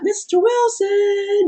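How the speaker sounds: put-on high, slow, drawn-out kid calling a neighbor; Dennis the Menace. Teacher mimics Dennis the Menace calling her husband, high and slow. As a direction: stretch it out like a pesky kid at the door. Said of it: "Dennis the Menace all the time".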